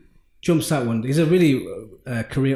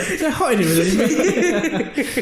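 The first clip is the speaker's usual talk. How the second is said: laughing; 9 semitones higher, much slower than usual. Real, not acted. Teased, laughing it off.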